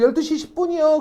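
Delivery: high, eager chorus reply, lightly voiced; crowd answering in chorus. Tour group answering the guide, in an eager high chorus. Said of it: "그래서 다들".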